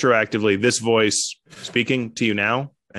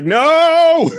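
high, loud drawn-out wail; mock-horrified scream; 6 dB louder than usual. Comedian shown a picture of himself shrimping, mock scream. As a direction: cry out in hammed-up horror. Acting out his shocked reaction.

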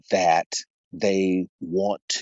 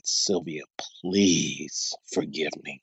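humble apology; 6 semitones lower, 6 dB quieter, slower than usual. Retold or imitated. Husband apologizing to his wife.

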